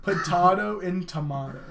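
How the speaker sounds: potato and tomato with broad AH; odd potato, tomato. Retold or imitated. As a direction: say potato as "potahto", tomato as "tomahto". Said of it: "said it that way".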